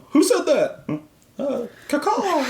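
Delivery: high, mock-innocent bird call; playful disguised voice. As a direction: fake a silly bird to cover up. Pretending to be a bird.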